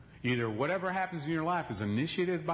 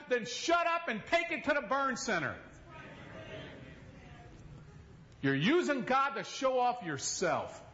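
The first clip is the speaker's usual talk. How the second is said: preaching at full force; 11 semitones higher than usual. Acted. Preacher scolding TV healers, raised and high. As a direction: thunder at the fake healers.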